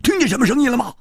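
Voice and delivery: high, impatient repeated calls; agitated, high-pitched questioning; anxious urging. A narrator's reading. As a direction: press urgently for an answer. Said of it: "胡铁花急着说".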